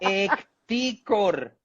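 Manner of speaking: Ecticor said very slowly, syllable by syllable; drawn-out nickname. Shown as a demonstration. Colombian man to interviewer, slowly drawing out Ecticor. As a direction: savour Ecticor, stretching every sound. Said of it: "de cariño me decían ecticor".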